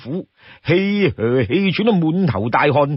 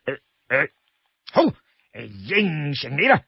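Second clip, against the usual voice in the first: hesitant grunts, then grudging yes; reluctant agreement; 4 dB quieter than usual. Acted. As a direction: hem and haw before giving in.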